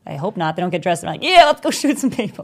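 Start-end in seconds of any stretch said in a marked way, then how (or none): 1.2-2.4 high, loud mock-eager voice; a hammed-up trigger-happy cop; gung-ho cop. Mocks eager cops.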